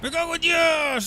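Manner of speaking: high drawn-out angry shout. Real, not acted. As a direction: yell the curse slowly. Fish escapes, loud curse.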